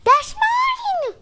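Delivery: very high, squeaky little squirrel voice; shrill cartoon squirrel protest. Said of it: "squeaked the squirrel".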